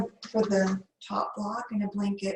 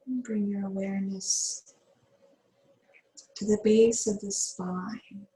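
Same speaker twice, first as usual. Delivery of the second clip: guided meditation. Acted. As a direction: ease the class into calm breathing. Yoga teacher leads breathwork.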